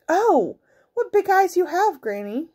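put-on high, astonished girl voice; wondering little girl. A narrator's reading. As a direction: marvel in a high child's voice.

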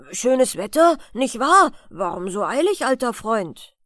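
loud hearty call from the roadside; horse calling out. Acted. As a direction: hail a passer-by loudly. Als er sich plötzlich angerufen hörte. (lauter, fröhlicher Zuruf des Pferdes)

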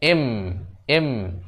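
এম said twice, each lengthened and separate; drawn-out এম. Shown as a demonstration. Teacher showing pupils the sound in the letter, এম drawn out. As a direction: sound out এম slowly as "emmm". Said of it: "এ উচ্চারণ হচ্ছে".

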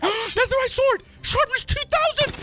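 high, loud thrilled cry at the loot; gasp, then shout the find in delight; excited gasp. Real, not acted. Gamer finds a sword, shouts with excitement.

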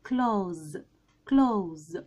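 "كلوز" in one drawn syllable ending in z; correct pronunciation. Shown as a demonstration. Teacher asks learners to listen, correctly pronounced "كلوز". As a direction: model "كلوز" with silent th and a z ending. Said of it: "فننطقها ازاي بقى".

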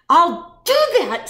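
high, loud, drawn-out grouchy screech; storybook shriek, slow and high. A narrator's reading. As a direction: screech it like a furious little bug. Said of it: "screeched the grouchy, grouchy ladybug".

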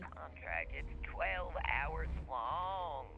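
low, slow and ailing; sore-throat voice. Pretend. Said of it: "you got a sore throat".

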